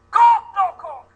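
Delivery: high, loud, slow mock-stern shout; doctor's refusal. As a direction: bark the refusal like an outraged Japanese doctor. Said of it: "The doctor goes".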